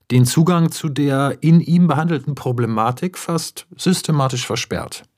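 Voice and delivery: usual speaking voice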